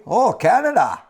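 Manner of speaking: put-on high sung-out Oh Canada in a Canadian accent; mock Canadian accent. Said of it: "keep this accent".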